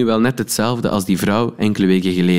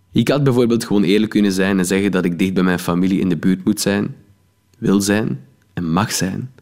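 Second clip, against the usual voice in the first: plain reading voice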